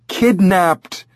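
high, emphatic parrot retort; indignant correction. Acted. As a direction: pitch it high to set them straight.

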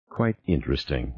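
quiet, scornful inner echo; bitter echo. Acted. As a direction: repeat it in disbelief, under the breath. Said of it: "Norman thought".

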